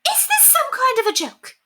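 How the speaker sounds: very high, indignant cry; offended society lady; outraged lady. Acted. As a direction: bristle at the insult.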